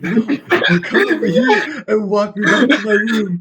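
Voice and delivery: high, drawn-out retold begging; scared plea. Scared friend begs to be walked back, high mock plea. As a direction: plead slowly in a high voice, half laughing.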